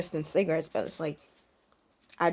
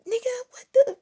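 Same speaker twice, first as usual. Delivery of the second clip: shocked disbelief; 5 dB louder than usual. Retold or imitated. Retold shocked reaction to his offer.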